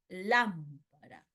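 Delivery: "Lámpara" slowly, LÁM... para; stress on antepenultimate syllable. Shown as a demonstration. Teacher giving an esdrújula example, slow "Lámpara" stressed on LÁM. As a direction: punch LÁM in "Lámpara", then drop off on para. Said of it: "el acento está en la antepenúltima".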